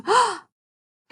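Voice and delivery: slow, startled gasp then hushed. Acted. Mouse sees Snake, a startled gasp. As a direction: catch your breath in fright.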